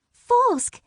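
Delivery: high fairy voice calling out a name; fairy cry. Acted. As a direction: call Falsk urgently, high. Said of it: "Rainbow Frost cried".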